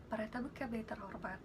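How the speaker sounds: soft in-flight cabin announcement; held-breath belly voice announcing; flight attendant announcement. Shown as a demonstration. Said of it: "Baru napas".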